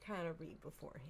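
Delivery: whispered aside. Real, not acted. Co-host whispering while reading the signature. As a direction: drop to a breathy hush mid-sentence.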